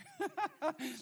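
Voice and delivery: high, loud laugh at own story; burst of laughter. Real, not acted. Preacher laughing at his own story to the congregation, loud high laugh. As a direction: let a big laugh break out.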